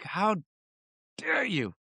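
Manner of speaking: high-pitched mock outrage, drawn out; indignant, retold anger. Singer recalls fury at the haircut, high indignant retelling. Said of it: "I was so angry".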